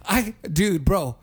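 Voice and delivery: normal talking voice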